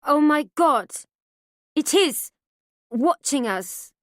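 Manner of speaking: loud panicked cry, slowing on watching us. A narrator's reading. Mother panicking at something watching, terrified. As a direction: terror rising as she stares up. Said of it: "her eyes fill with panic".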